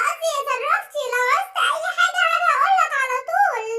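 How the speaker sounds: normal talking voice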